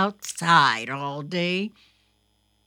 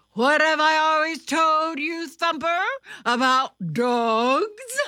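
father scolding; 9 semitones higher, 6 dB louder than usual. A narrator's reading. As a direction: lecture a child who broke the rule.

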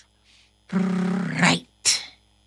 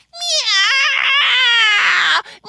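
high loud yowling meows; cat howling; 4 dB louder than usual. Acted. Cat howls at the alarm, high and loud. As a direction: yowl at the alarm. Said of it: "An itty bitty howls".

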